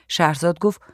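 ordinary delivery

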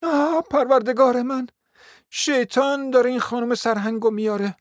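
high-pitched exclamation of dismay; alarmed cry. A narrator's reading. Mother exclaiming at an unwelcome visitor, high alarmed cry. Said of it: "از جای خود پرید و فریاد برآورد".

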